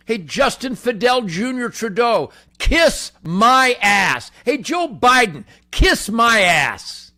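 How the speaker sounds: high, loud and slow defiance; loud defiant yell; defiant shout. Real, not acted. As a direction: bellow it at the emperor. Shouted insults at politicians.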